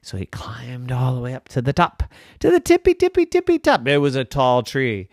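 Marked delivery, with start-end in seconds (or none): 2.4-3.9 very high, sing-song tippy top; tippy top squeak. A narrator's reading. Narrator reaching the treetop, squeaking high. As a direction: climb the voice up to the very top.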